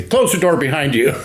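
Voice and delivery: high put-on boss voice; Father Hayes. Priest boss as a nervous worker arrives, high-pitched impression. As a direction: imitate a priest boss giving an order. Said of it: "Father Hayes im-, impression".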